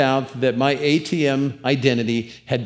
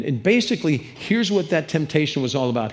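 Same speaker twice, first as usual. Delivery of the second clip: usual speaking voice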